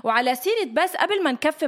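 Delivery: ordinary talk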